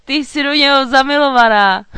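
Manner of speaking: loud, high teasing cry; gleeful shout. A narrator's reading. Knight discovering the dragon girl's crush, shouting gleefully. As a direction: crow it out, highly amused. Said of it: "vykřikl".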